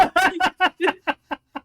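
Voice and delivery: high, loud cackling laugh; gleeful laugh. Real, not acted. After cursing the airport, a gleeful cackle. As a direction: burst out laughing at your own win.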